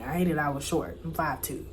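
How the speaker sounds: usual speaking voice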